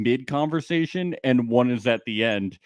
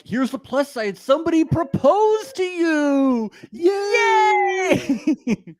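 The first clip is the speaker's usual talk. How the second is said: very high, hammed-up cheer building to a shout; mock cheering; 5 dB louder, much slower than usual. Mark finding a plus side for Ash, mock cheerleading. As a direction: start high, swell into a stretched squealed "yay". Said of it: "Mark is like".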